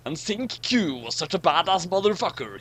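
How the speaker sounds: normal talking voice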